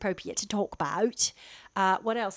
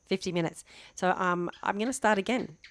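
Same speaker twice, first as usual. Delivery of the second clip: ordinary talk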